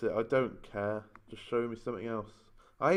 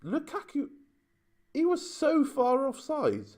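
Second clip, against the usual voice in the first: high indignant squeal of disbelief; outraged squeal. Real, not acted. Gamer shrieks at the offside goal in disbelief. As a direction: shriek it like a cheated fan.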